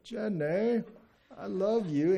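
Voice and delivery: high, lovesick movie character; high-pitched earnest declaration; Forrest Gump. Acted. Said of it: "the movie Forrest Gump".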